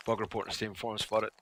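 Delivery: ordinary talk, nothing marked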